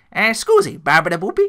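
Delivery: hammed-up Italian accent; silly Italian accent. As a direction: toss it off like a cartoon Italian. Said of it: "fun accent".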